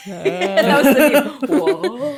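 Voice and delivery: laughing. Real, not acted. Laughing at meme face.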